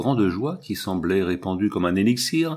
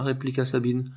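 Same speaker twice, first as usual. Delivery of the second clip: usual speaking voice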